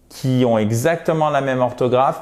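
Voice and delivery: usual speaking voice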